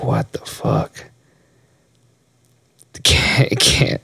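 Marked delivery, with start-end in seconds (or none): 0.0-1.0 slow, hushed whisper of disbelief; stunned disbelief. Real, not acted. Reacting to the book's cover, stunned slow whisper. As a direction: whisper it slowly, dumbfounded.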